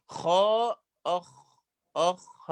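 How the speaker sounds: أخ with a breathy rasp of air; letter demo. Shown as a demonstration. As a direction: let air run through أخ. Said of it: "هذا الصوت ضعف في المخرج". (نطق الخاء الساكنة بالهمس)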